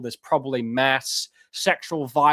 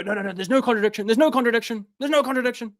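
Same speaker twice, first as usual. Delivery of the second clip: insistent denial rising higher, quick start; mocking denier; 9 semitones higher, much faster than usual. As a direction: parody someone repeating the denial. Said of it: "And you, you go".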